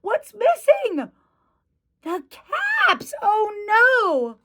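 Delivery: high-pitched, storybook alarm; acted dismay. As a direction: gasp out the loss for young listeners. Storyteller reacts to missing caps.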